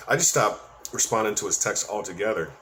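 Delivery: normal talking voice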